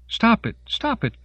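very high repeated cry; frantic plea. A narrator's reading. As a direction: shriek it twice at the fighters. Denise screams at the fighters.

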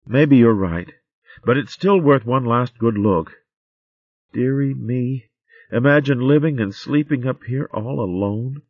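lightly voiced, thoughtful girl; young hedgehog maid. Tansy searches attic.

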